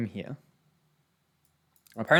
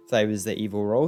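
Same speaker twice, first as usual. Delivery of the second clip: ordinary talk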